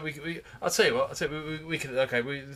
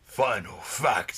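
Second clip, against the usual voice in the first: announcer voice; 4 dB louder, much slower than usual. Acted. Slow announced segment title.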